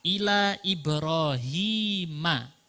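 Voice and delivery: slow, stretched Ila Ibrahima with clear final a; fathah ending. Shown as a demonstration. Teacher modelling Ibrahima for students, slow and clear. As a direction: spell out Ibrahima with a fathah at the end.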